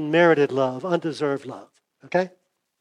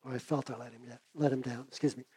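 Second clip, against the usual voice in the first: real fading voice, much softer; losing voice; 3 semitones lower, 14 dB quieter than usual. Real, not acted. Preacher confesses letting God down, voice giving out. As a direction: barely get it out as your voice gives way. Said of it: "Losing my voice".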